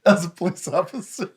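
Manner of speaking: laughing through the words; cracking up mid-sentence. Real, not acted. Laughing at tampon idea.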